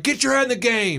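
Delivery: mildly barked sports-parent order; sample parent yell. Shown as a demonstration. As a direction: imitate a parent getting on a kid mid-game. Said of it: "yell something negative at your kid".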